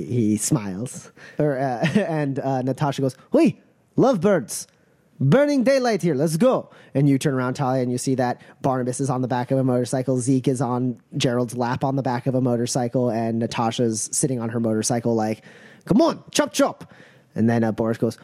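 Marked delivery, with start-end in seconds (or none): 3.3-6.7 high-pitched hurrying holler; impatient call. Acted. Crew leader calls to the kissing pair, impatient. 15.9-16.9 high, brisk chivvying; impatient hurrying. Acted. Rider waiting on her motorcycle hurries a friend, impatient.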